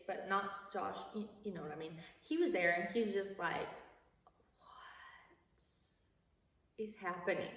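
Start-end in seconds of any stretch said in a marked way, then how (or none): 4.6-7.5 stunned whisper. Retold or imitated. Guy stunned by swollen dancer.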